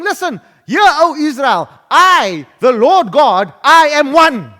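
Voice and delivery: loud, forceful proclamation; God's emphatic declaration. Acted. Preacher voices God addressing Israel, loud and commanding. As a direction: ring out each phrase as divine command. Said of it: "and he's saying".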